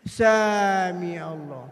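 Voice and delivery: very slow, low drawl; dragged-out prayer words; groggy, worn out. Retold or imitated. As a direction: imitate a dazed worshipper running out of energy. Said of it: "Udah teler, Pak".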